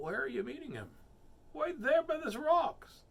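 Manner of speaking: high-pitched character voices; lively picture-book voicing; storybook animal dialogue. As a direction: pitch leaps up for the reply. Fox asks, mouse answers high.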